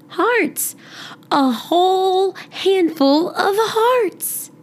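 put-on high, slow, thrilled girl voice; excited. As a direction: marvel at the handful of hearts. Girl excited seeing her mom's cards.

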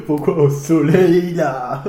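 slow, loud question through laughter; laughing. Real, not acted. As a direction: laugh while you ask it. Laughing at the smoking image.

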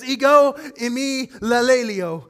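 slow, deliberate Greek "Ego eimi lalelio"; Greek reading. Shown as a demonstration. Preacher showing the congregation the Greek, slow and careful. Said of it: "in Greek, it sounds more like this".

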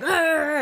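loud mock growling yell; imitated angry roar. Punched man's reaction retold to friends, mock roar. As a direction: roar it high and loud, hammed-up. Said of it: "Did he cry?".

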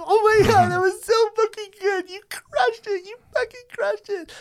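very high, squeaky happy crying; mock tearful praise. Sister to comic after his set, mock tearful squeal. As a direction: imitate a sister weeping with pride. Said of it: "My sister's crying".

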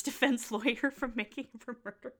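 talking through real giggles; breaking into laughter; laughing. Real, not acted. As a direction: crack up mid-line. Cracks up mid-sentence.

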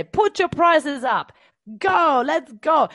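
mock pushy business coach. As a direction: business coaches out there urging clients, mock hype. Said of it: "there is business coaches out there that say".

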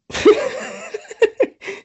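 high breathy burst of laughter. Real, not acted. Friend cracks up retelling the movie, high laugh. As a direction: crack up at the story.

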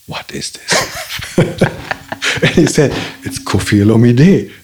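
normal talking voice

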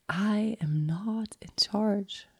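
a slow, breathless whisper of the words; whispered realization. Retold or imitated. Realizing in ceremony she is not in charge, whispered. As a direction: let it slip out in awe, barely voiced. Inner realization repeated like mantra.

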